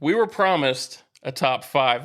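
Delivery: normal talking voice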